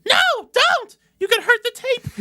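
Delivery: very high, loud squeaky voice; shrill little Toad cry. Acted. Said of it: "She's fucking Toad".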